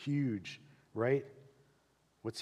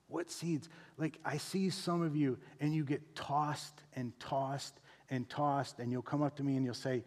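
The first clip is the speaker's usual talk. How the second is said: ordinary talk, nothing marked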